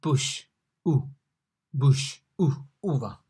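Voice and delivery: bush, U, uva with one vowel; comparison demo. Shown as a demonstration. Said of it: "like U in bush".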